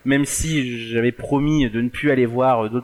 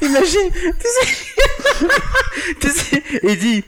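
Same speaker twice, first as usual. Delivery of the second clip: laughing; 13 semitones higher, much slower than usual. Real, not acted. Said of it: "c'était génial".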